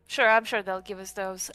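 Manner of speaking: usual speaking voice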